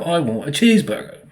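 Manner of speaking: high-pitched mock English accent; exaggerated English "cheeseburger", r's dropped. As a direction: put on a caricatured English accent. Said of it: "that's not how you say it".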